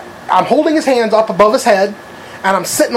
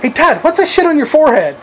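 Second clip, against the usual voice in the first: mock innocent call-out; 7 semitones higher than usual. Calls out friend before the cashier.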